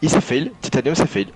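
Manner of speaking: high, excited cry; excited exclamation. Real, not acted. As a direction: exclaim the mistake. Reacting to driver's mistake. (exclamation excitée)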